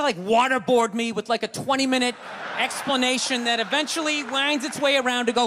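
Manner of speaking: ordinary talk, nothing marked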